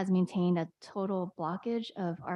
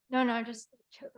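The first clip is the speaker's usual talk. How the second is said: strained, choking voice; 4 semitones higher than usual. Real, not acted. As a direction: cut off by a choke. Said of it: "Sorry, I'm choking".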